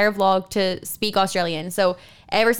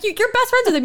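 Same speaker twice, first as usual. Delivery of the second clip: put-on much higher childish plea; high pleading kid; 13 semitones higher, 3 dB louder, faster than usual. Kids remind dad of his best friend, high and pleading. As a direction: insist like an upset little kid. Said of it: "we-, we're like".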